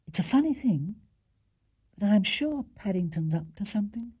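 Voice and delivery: a puzzled mother musing; wife voice. Acted. Wife musing to husband in bed, lightly voiced. Mrs Brown voiced at midnight.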